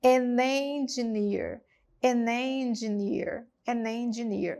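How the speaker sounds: "An engineer." slow and joined, repeated; linked repetition. Shown as a demonstration. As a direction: say "An engineer." linked each time. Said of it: "junto tudinho quando eu falo".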